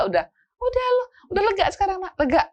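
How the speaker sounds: high, loud pleased calling-off; excited parent. Retold or imitated. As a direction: wind the kid down, still worked up. Parent calming child after shouting.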